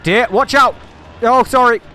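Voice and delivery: loud high yelp of alarm; startled shout. Real, not acted. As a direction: shout it as the car hits someone. Crashing the car.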